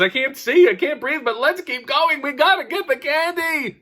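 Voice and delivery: mock frantic kid who won't quit trick-or-treating; desperate kid. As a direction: press on, half blind, for candy.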